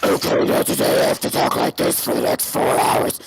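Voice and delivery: whispered rasp, forced deep; mock strained old lady forcing a deep voice; strained scratchy voice. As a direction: rasp it out like a worn-down throat. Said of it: "what it did to her vocal cords".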